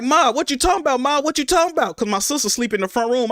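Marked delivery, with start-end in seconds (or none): 0.0-2.0 high, urgent questioning; retold panic. Son on phone with dying mother, alarmed. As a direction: plead to know what she means, pitch raised.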